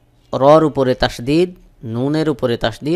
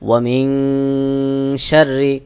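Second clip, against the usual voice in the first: very slow chanted recitation with ghunnah; slow Quran recitation; much slower than usual. Shown as a demonstration. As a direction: draw out each word in tajweed. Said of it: "গুন্নাহ করতে হবে". (ধীরে তাজবীদসহ তিলাওয়াত)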